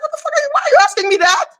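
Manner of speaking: high, fast, incredulous; retold disbelief. Retelling being asked for the number, high and fast. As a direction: blurt it out in shock. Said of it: "I was like".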